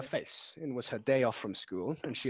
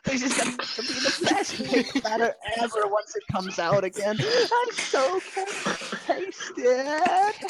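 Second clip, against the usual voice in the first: very high, loud giddy glee; 14 semitones higher, 5 dB louder, slower than usual. Acted. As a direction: cackle with excitement. Pinkie gleeful, high, laugh.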